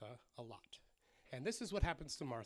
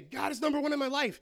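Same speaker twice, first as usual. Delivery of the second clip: loud, high, mock declaration; hammed-up avowal, pushed out forcefully; proud declaration; 9 semitones higher, 10 dB louder than usual. Believers claiming God comes first, loudly declared. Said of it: "A lot of times we say".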